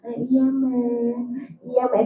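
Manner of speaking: slow, soft, meek yes; dutiful child to mother, put-on meek and slow; fake obedience. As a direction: sweet-talk her while planning to ignore her.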